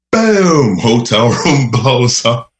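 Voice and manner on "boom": high, loud mock explosion boom; spoken explosion noise. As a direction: shout the blast like a kid's sound effect. Imitating the hotel room explosion.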